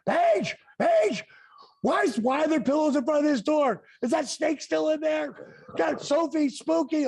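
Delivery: high-pitched panicked calling of Paige; wife panicking about the snake, shrill mock scream. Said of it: "Kimberly screaming".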